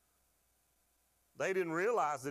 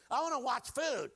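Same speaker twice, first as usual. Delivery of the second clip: higher, louder whine; whiny flesh; 8 semitones higher, 3 dB louder than usual. Retold or imitated. Preacher's flesh begging food shows, higher louder whine. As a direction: beg like a spoiled child.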